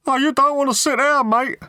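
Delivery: very high-pitched, cheery passer-by voice; cheery stranger. A narrator's reading. Stranger in overalls warning Arthur off the seat, cheery. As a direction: pipe up as a jolly bloke passing by. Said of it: "said a passing stranger in overalls to Arthur cheerily".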